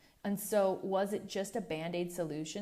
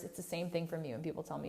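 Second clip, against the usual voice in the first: ordinary talk, nothing marked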